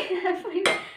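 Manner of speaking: short embarrassed giggle; laughing at self. Real, not acted. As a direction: burst into a sheepish laugh. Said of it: "I'm really laughing".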